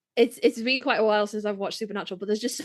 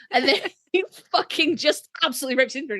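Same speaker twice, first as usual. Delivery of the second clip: ordinary delivery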